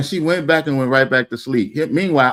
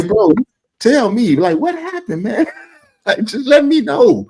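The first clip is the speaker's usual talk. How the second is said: high-pitched joking interrogation; mock concerned questioner; 7 semitones higher than usual. Friend pretending to question him at the hospital, mock pleading. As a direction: press him for answers in a high, put-on voice.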